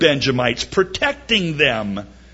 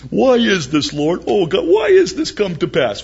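mock lament; 4 semitones higher, 3 dB louder than usual. Mocking the lament again.

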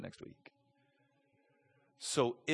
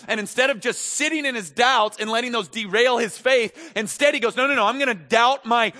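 higher, louder preaching; fervent preaching; 8 semitones higher, 8 dB louder than usual. Acted. Preacher urging the congregation to doubt their doubts, fervent.

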